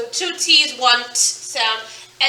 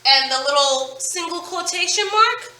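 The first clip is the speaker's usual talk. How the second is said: normal talking voice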